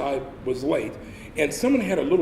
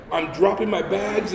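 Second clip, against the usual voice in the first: normal talking voice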